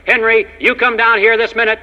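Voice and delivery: high, loud scolding yell; yelling wife. Retold or imitated. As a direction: shout it up the attic stairs like an angry wife. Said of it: "she yelled up".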